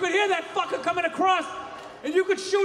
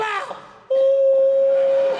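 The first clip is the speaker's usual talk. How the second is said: gunshot cry; 12 semitones higher, 8 dB louder than usual. Acted. Gunshot in the dark.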